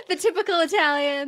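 amused tease through a laugh. Real, not acted. Host laughing as she teases Michelle about Italian gestures. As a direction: laugh it out, teasing about hand-talking Italians.

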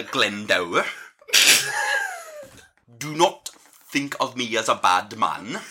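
Welsh dwarf father, phrase by phrase; exaggerated, deliberate Welsh lilt; Welsh accent. Warden reassures and instructs his prisoners, Welsh accent. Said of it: "a Welsh accent".

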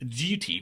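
duty with a y-glide before the u; sliding J sound. Shown as a demonstration. Host showing a Mid-Atlantic feature, "duty" with a sliding glide. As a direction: say "duty" as dyoo-tee. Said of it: "like a sliding J sound in front of the U".

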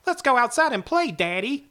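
put-on high little boy dog voice. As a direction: squeak it like a small boy. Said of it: "They don't sound like".

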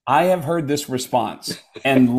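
ordinary talk, nothing marked

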